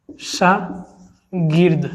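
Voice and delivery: शागिर्द dragged out very slowly, each sound held; slow demo pronunciation. Shown as a demonstration. Teacher to exam students, saying शागिर्द very slowly. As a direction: model शागिर्द slowly so they hear the र before द. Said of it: "इस प्रकार होता है".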